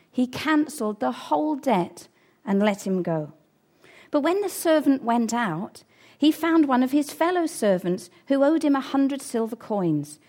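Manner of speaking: ordinary talk, nothing marked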